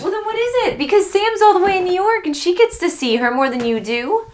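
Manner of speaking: high, loud, heated accusation; angry outburst. Acted. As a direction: let the quarrel boil over into shouting. Quarrel escalates over visiting mother.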